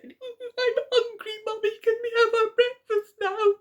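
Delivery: very high squeaky little cub; Baby Bear. Acted. Baby Bear asking Mummy for breakfast, high and squeaky. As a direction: plead like a hungry small child. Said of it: "And Baby Bear said".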